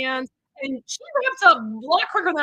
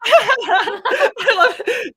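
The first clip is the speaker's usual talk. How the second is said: laughing out the words, very slowly and higher; delighted laugh; 9 semitones higher, 7 dB louder, much slower than usual. Real, not acted. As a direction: gush with delight through a laugh. Delighted reading a comment.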